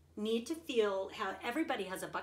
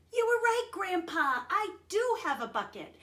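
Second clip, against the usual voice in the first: loud, high happy shout; boy shouting to grandpa in delight; excited shout; 10 semitones higher, 5 dB louder than usual. Acted. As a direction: burst in shouting with joy. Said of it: "he shouted".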